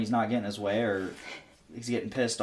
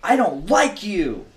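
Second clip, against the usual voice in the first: put-on kid pouting; 5 semitones higher, 6 dB louder, slower than usual. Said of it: "he goes".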